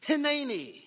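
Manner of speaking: Hineni said as hih-NAY-nee, stress on NAY; Hineni, clear and falling at the end; Hebrew word Hineni. Shown as a demonstration. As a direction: model Hineni for the church to repeat. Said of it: "I want this word to be ingrained in you".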